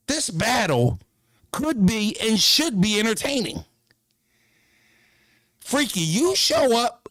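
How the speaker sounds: high, slow, stretched ring-announcer voice; hype announcer. Pretend. Streamer hyping the battle for the chat, announcer style. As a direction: sell the fight like a ring announcer.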